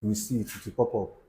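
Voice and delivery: usual speaking voice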